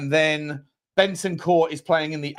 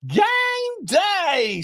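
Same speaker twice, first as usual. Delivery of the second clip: excited. Retold or imitated. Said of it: "Drewzilla is in the house saying".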